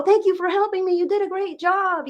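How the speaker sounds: high, bright praise for a little helper; sing-song thanks to a small child; praising a child. Pretend. Mother thanking her child for folding laundry, high and sing-song.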